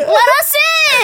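very high, loud, slow wail; mock pleading shout. Locked out at the window, begging to be let in, hammed-up shout. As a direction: beg through the window in a hammed-up howl.

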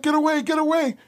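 high, panicky mock governor; frightened governor. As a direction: squeak it out in a panic. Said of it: "covers his wife like this".

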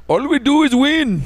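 high, slow mock Indian accent. Host demonstrates an Indian Trump boasting, exaggerated Indian accent. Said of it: "No, you just do Indian".

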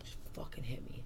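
unvoiced whisper of a drunk bully; whispered plea. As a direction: breathe it out, no voice. Drunk bully asks to be hit.

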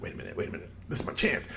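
whispered, scheming under the breath; sneaky whisper. Retold or imitated. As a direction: hiss it like a sneak seeing his chance. Drake sneaking in to pin.

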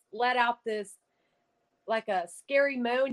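ordinary delivery

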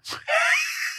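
a shrill, very high mocking shriek run up a scale; high teasing singsong shriek; mocking vocal scale. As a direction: shriek a cruel little scale to tease. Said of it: "that was just so mean".

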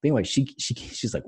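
normal talking voice

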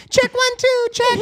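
very high, thin mic check voice; high squeaky mic check. Retold or imitated. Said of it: "we were like".

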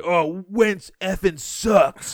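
imitating a loud bar fan, high and drawn out; slow, loud mock complaint carrying across the bar; retold loud heckle. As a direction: blurt it out loud like a disgusted fan. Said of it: "like, out loud".

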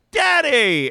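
high, loud hammed-up call; drawn-out, over-the-top shout; mock dramatic cry. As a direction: belt it out as the big reveal. Acted reveal cry from the story.

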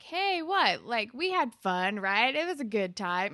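loud, imitated smarmy brush-off; mock cajoling. Dean comes up to her, mock cajoling. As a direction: coax her loudly, imitating a smarmy guy.

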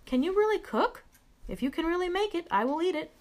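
high-pitched storybook cat, sceptical; doubtful cat. A narrator's reading. Cat questions Little Bear's cooking, high and doubtful. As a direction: voice a cat unsure of the cook.

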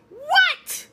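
high-pitched mock-shocked cry; shocked exclamation. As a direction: shoot way up in disbelief. Shocked reply to the revelation.

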